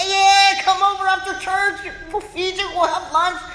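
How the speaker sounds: hammed-up eager host; jolly dad. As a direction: boom out a welcome to the church. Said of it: "my dad's always like".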